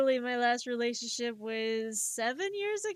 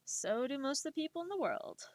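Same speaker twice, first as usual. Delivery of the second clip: whisper; 2 semitones higher, 6 dB quieter than usual. Real, not acted. Whispered aside.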